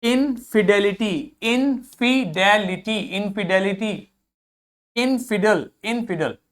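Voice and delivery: Infidelity very slowly, in-fi-de-li-ty; slow pronunciation drill. Shown as a demonstration. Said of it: "इसको देखिए". (Infidelity धीरे-धीरे)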